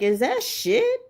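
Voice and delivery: high, rising incredulous question; baffled disbelief. Retold or imitated. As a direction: peer at it and ask in high-pitched bafflement. Puzzled first look at package.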